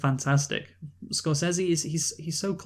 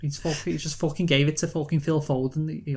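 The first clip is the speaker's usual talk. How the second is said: Jamie Carragher impression; 3 semitones higher than usual. Imitating Carragher commentating Liverpool v Man City in a Scouse accent. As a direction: mimic a Scouse pundit moaning at a goalkeeper's mistake. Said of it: "So he goes".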